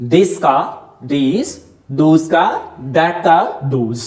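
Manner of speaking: each word drawn out one by one, very slowly; very slow drill. Shown as a demonstration. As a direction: spell the pairs out slowly for students. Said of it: "आप ऐसा use करोगे".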